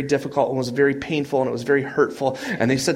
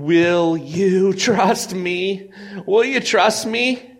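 pleading question; 6 semitones higher, 4 dB louder, much slower than usual. Pretend. God's question, preached.

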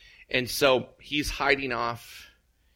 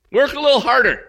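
"Work a little harder" much higher and louder; loud insistence; 14 semitones higher, 12 dB louder than usual. Acted. Ahab rallies himself, repeating "Work a little harder" high and loud.

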